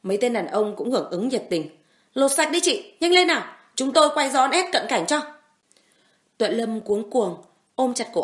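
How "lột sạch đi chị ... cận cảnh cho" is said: high, loud jeering shout; jeering men. Acted. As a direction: egg her on like a rowdy gang. Men egging on the assault.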